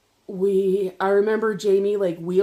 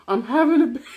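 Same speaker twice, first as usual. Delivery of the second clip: higher, louder mock wail; wailed announcement; 5 semitones higher, 5 dB louder, faster than usual. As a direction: act out wailing it at a concerned guard. Acted wail to security guard.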